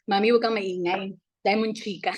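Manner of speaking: ordinary talk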